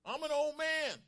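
very high, loud outburst; angry shout. Acted. Zacharias shouts back at the angel, angry. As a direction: shout it out in protest. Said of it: "he's angry and shouts out to the angel".

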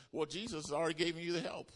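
usual speaking voice

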